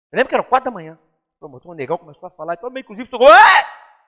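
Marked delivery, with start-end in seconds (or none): 3.3-4.1 high loud cry of protest; retold yell. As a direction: burst out with a sharp shout. (grito alto e agudo)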